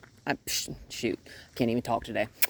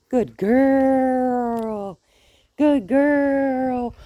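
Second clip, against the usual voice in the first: very high, louder drawn praise; 8 semitones higher, 7 dB louder, much slower than usual. Real, not acted. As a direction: gush over the dog. Praising dog.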